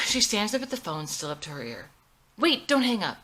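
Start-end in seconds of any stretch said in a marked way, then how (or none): 2.4-3.2 urgent plea. Acted. Pleading into phone.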